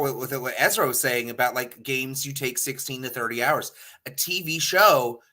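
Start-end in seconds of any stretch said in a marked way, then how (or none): none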